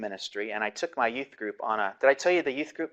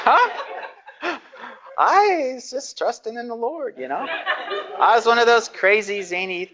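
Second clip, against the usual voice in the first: much higher, laughing as you answer; 10 semitones higher, 4 dB louder than usual. Real, not acted. Pastor laughing back at a student's question. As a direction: laugh it off, playing the crazy youth pastor.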